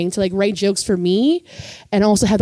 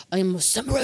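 lightly voiced fake Japanese accent, quiet; a hint of a put-on Japanese accent; mock Japanese accent; 8 dB quieter, faster than usual. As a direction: imitate a mock Japanese samurai voice, understated. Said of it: "my Japanese voice".

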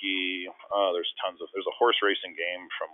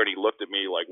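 ordinary delivery

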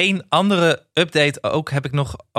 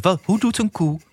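put-on coaxing a toddler; 8 semitones higher than usual.

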